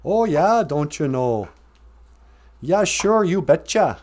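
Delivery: put-on lilting, high Minnesota-Scandinavian accent; mock Scandinavian accent. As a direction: exaggerate a dumb Scandinavian-Midwest singsong accent. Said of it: "that dumb Scandinavian accent".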